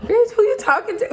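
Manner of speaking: high-pitched, incredulous clapback; hammed-up outraged disbelief; mock indignation. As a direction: squeal it like you cannot believe the nerve. Acts out indignant inner reaction.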